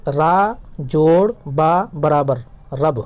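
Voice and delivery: یہ را جوڑ با برابر رب said slowly, syllable by syllable; slow spelling out. Shown as a demonstration. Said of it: "دھیان سے سیکھیے". (آہستہ آہستہ ہجے کر کے)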